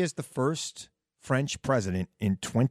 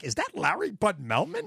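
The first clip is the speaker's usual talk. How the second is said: surprised; 10 semitones higher than usual. Retold or imitated. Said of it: "I'm like".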